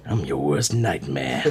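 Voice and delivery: low slow movie tough guy. Acted. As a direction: deliver it like a dramatic action hero. Dramatic pause, tough detective line.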